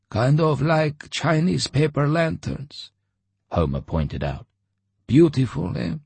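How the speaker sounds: lightly voiced old Homer; old man voice. A narrator's reading.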